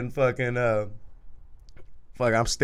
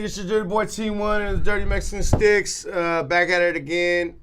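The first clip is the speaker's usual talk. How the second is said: higher announcer-style outro; podcast sign-off; 6 semitones higher than usual. Acted. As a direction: sign off the show like a host. Show sign-off.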